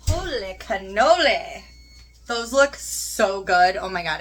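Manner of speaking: slow, loud, awed delight. Real, not acted. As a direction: savor each word, amazed. Seeing the finished food.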